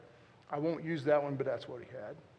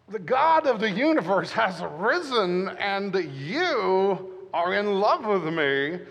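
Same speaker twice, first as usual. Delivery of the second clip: slow, loud, higher mock proclamation; mock grand pronouncement; 4 semitones higher, 8 dB louder, slower than usual. Husband mimics girlfriend's grand claim, slow and loud. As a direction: lay it on like a decree. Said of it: "She's like".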